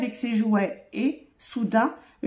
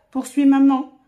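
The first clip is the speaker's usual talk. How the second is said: ordinary delivery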